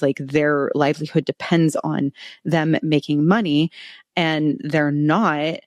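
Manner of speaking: ordinary delivery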